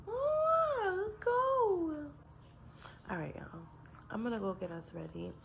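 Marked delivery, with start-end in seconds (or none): 0.1-1.9 very high drawn-out coo. Real, not acted. Mother reacting to grabbing baby.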